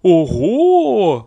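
loud, high, long whoop; surprised horse. A narrator's reading. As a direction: cry out high in astonishment as the horse. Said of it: "удивилась лошадь". (громкий удивлённый возглас лошади)

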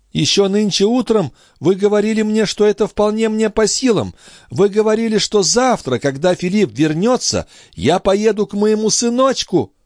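very high, agitated protest; distraught young mother. Acted. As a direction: protest desperately in a high woman's voice. (очень высокий, взволнованный голос матери)

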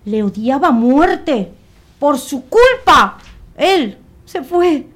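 high, loud, drawn-out narration of bitter blame; verbitterde uitbarsting. A narrator's reading. Narrator voices Dulce's hatred and blame.

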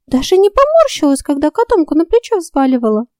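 high, probing fairy voice; curious fairy voice. A narrator's reading. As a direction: grill her as a nosy fairy.

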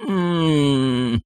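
soft, drawn-out hummed moan; long weak groan sliding gently down. A narrator's reading. Someone injured comes to, groaning softly. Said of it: "раздался стон".

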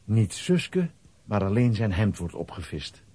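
usual speaking voice

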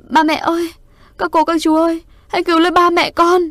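high, loud desperate scream; screaming in anguish. A narrator's reading. Little sister in front of the burning house screaming for her parents. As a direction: shriek for help at the top of her lungs. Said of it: "em gái tôi gào lên dữ dội".

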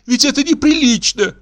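high-pitched tearful wife; scandalised, weepy outcry; tearful protest. A narrator's reading. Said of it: "зарыдала Каролина".

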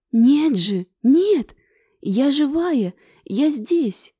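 excited. Acted. Ingrid insists she is alive.

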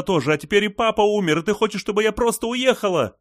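high, loud hysterical tirade; distraught exclaiming. A narrator's reading. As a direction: pour out horrified reproach, pitch climbing. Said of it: "воскликнула Ли".

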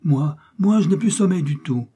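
put-on high, loud, eager little boy; martial child. A narrator's reading. Child refusing bed to his mother, martial and high. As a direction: pipe up as a defiant boy soldier. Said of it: "répondit l'enfant d'un air martial".

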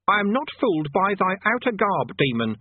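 high, grand archaic declaration; pompous conjurer. Acted. Conjurer accusing Rincewind of being a demon, grandly theatrical. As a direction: boom it like a stage sorcerer. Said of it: "In a more normal voice".

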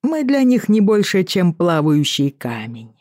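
casual lilting Irish sea captain; offhand, in a light Irish brogue; Irish accent. Acted. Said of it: "с певучим ирландским акцентом".

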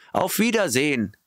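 high, called-out goodbye; calling farewell. A narrator's reading. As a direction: call out the goodbye again. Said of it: "riefen sie im Chor". (hoher Abschiedsruf)

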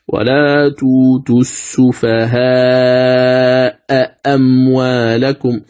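"السفهاء أموالكم" with both hamzas said fully, slow; both hamzas pronounced fully. Shown as a demonstration. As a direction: recite slowly, articulating each hamza in "السفهاء أموالكم". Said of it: "هكذا إذا حققت الهمزتين".

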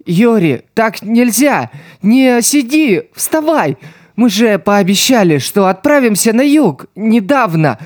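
high, breaking, tearful begging; sobbing plea to a dead friend, pitched far up; crying plea. A narrator's reading. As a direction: weep as you beg her to get up. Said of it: "плача говорил он".

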